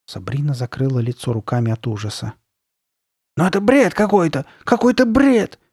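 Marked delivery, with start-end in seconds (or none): 3.4-5.6 very high, loud insistence; piercing voice. A narrator's reading. Carly Beth refusing to believe, piercing voice. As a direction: repeat it shrilly, climbing higher. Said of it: "твердила Карли Бет пронзительным голосом".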